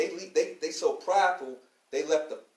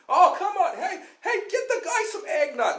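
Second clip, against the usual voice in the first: much higher, louder, laughing party voice; mock jolly host; 14 semitones higher, 6 dB louder than usual. Rich party host offering the worker eggnog, hammed-up jolly. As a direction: ham up a tipsy host handing out eggnog.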